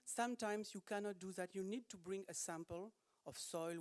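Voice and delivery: ordinary delivery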